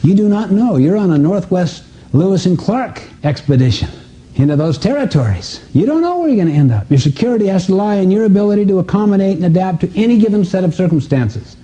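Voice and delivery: ordinary delivery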